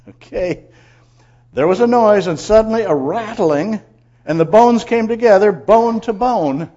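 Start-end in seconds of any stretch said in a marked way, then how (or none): 1.5-6.7 high, loud, suspenseful verse reading; dramatic scripture proclamation. Acted. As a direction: proclaim the wonder, drawing out the final words.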